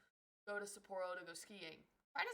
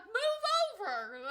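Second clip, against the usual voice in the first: loud, high yell; yelling at tourists on the train; shouted; 13 dB louder than usual. Retold or imitated. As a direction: bark it at the blockers. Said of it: "I'm like".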